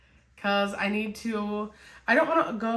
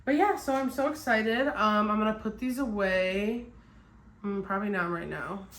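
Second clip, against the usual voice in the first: ordinary delivery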